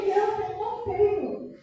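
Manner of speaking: high, slow, sing-song "I don't have it"; pained refusal. Retold or imitated. Woman telling the crying child she has no money, pained. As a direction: tell the child no with a breaking heart.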